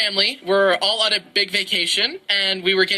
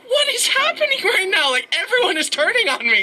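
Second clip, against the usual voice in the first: much higher, indignant disbelief; shocked outrage; 11 semitones higher than usual. Retold or imitated. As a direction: squeal it as everyone turns on you. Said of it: "I'm like".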